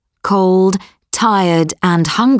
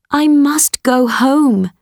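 put-on higher, earnest girl voice; promising daughter, pitched up; young girl voice; 7 semitones higher than usual. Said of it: "she said to the beast".

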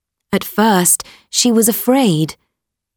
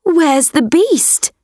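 put-on higher, puzzled girl voice; young girl voice; 9 semitones higher than usual. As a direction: ask surprised, pitched up. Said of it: "asked Beauty".

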